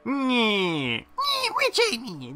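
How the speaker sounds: high, nasal cackling witch squawk. Acted. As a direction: screech it like a storybook witch. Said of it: "Did you like my witch voice".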